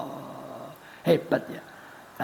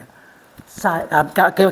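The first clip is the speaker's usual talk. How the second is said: usual speaking voice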